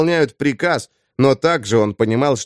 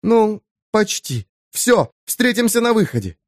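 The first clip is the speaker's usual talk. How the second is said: higher, urgent woman's voice; lightly voiced woman, firm and quick; Eva's voice; 8 semitones higher than usual. A narrator's reading. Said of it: "через небольшую паузу добавила".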